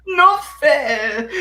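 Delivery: high fake-tearful protest; mock whining. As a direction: whine it like a wronged fan. Mocks upset AEW fans.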